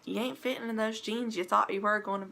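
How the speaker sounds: usual speaking voice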